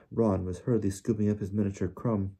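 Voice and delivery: ordinary delivery, nothing marked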